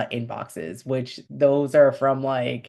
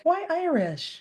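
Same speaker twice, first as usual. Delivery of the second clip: high-pitched mock question, much higher; mock curious asker; 14 semitones higher, slower than usual. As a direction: squeak it like a puzzled friend. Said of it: "people are like".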